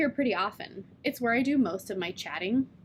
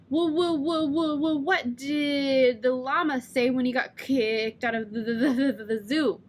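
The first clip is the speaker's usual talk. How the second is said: stuttered joke setup. Shown as a demonstration. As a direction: push through the joke despite the blocks. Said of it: "can't get the words out".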